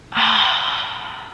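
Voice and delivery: long exasperated sigh; dad sighs, too tired to argue; weary sigh. Retold or imitated. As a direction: let out a tired, fed-up breath. Dad's weary reaction.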